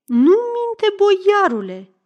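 put-on high, loud old woman's protest. A narrator's reading. Old woman defending the girl to the boyar, high protest. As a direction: butt in as a shrieking old woman. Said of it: "zice bătrâna din săliță".

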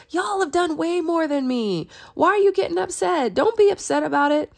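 smooth it over, flatter them up high; sweet-talking. Retold or imitated. Gideon soothing the angry Ephraimites, sweet-talking. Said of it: "Gideon sweet-talked the Ephraimites".

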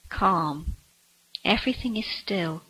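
usual speaking voice

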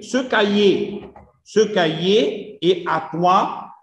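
very slow, syllable by syllable French reading; slow demo reading. Shown as a demonstration. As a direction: read it out slowly for the class. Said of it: "teacher reads sentence aloud". (قراءة بطيئة جدا للجملة الفرنسية)

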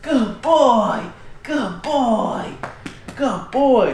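high, loud gushing praise; shower the pup with happy praise; excited praise. Real, not acted. Owner praising the puppy for bringing the antler back, high and gushing.

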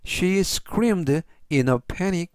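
very slow, careful English; slow model sentence. Shown as a demonstration. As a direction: read it slowly for learners. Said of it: "전체적 의미는". (아주 천천히 또박또박 읽는 예문)

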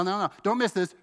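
usual speaking voice

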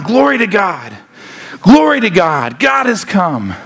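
lively proclaimed praise; shouting excited angels. Acted. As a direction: proclaim it like jubilant angels, without a full shout. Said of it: "and they're shouting".

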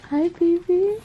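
high cooing hello to a pet. Real, not acted. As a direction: coo at the little one.

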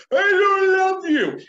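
put-on high, loud, slow yelled child line; child shouting rejection at the teacher. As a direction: scream it like an angry kid. This child goes.

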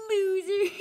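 loud laughing loser taunt. Real, not acted. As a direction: laugh it in his face. Taunting after winning.

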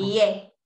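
"Ye" cut short, the wrong Spanish-style sound. Shown as a demonstration. Teacher shows the class the mistaken "Ye". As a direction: say "Ye" the way students get "yellow" wrong. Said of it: "No es ye".

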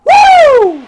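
high, loud "woo"; long whoop of triumph; celebratory whoop. Real, not acted. Gamer capping a great inning, celebratory whoop. Cheering own team.

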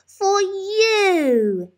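put-on very high, bright small-boy voice; beaming toddler. A narrator's reading. Little one giving his mum toys, high and proud. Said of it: "he beamed".

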